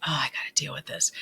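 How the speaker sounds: whispered aside to himself; exasperated whisper. Retold or imitated. Boss whispering his frustration about the awkward patient. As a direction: breathe it out under your breath.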